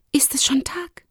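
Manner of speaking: high, half-whispered question; waking young Lumi. Acted. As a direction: ask it high and hushed, just woken. Lumi just woken by steps. (hohe, leise Stimme, gerade geweckt)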